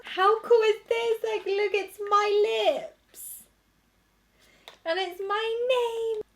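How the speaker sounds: high and thrilled, slow at the end; excited. Real, not acted. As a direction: gush over it, high-pitched. Showing her name on packaging.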